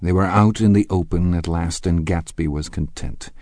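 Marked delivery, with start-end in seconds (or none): none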